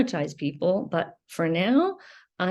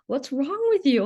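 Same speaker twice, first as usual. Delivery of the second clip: higher-pitched mock parental reproach; scolding parent; 6 semitones higher than usual. Parent to their crying child, mock scolding. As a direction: imitate an exasperated parent, pitch raised. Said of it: "and he's saying".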